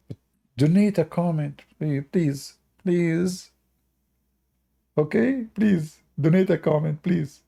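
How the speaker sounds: ordinary talk, nothing marked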